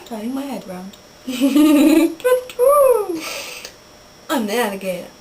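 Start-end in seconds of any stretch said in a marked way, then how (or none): 1.3-3.7 loud hooting, long last note; owl hoot. Pretend. Playing animal noises as an owl, hooting. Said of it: "I am an owl".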